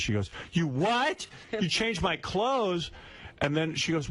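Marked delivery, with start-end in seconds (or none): 0.5-2.9 high, slowed mock outrage; shocked disbelief. Woman confronts man who changed her clothes, mock shocked. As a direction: imitate an appalled woman, pitch jumping up.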